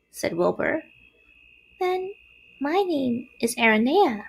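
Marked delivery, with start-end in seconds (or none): 1.8-4.2 high, small spider voice; little spider. A narrator's reading. Second spider names herself, small voice. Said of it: "said the spider".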